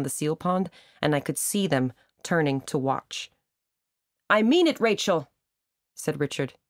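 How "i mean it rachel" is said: high and loud public declaration; loud proposal pitched up high; very loudly. A narrator's reading. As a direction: call it across the park to her. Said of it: "very loudly now".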